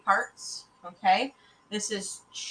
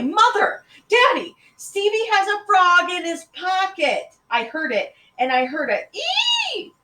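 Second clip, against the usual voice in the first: high, loud tattling shriek; squealing little sister, drawn out; screaming girl; 12 semitones higher, 9 dB louder than usual. A narrator's reading. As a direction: cry out in alarm to both parents. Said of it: "a loud scream from Sally".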